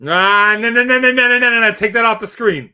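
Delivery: loud, high rapid-fire nos; urgent yelled objection; frantic protest. Real, not acted. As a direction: shout it down in a rush. Protesting what is on screen.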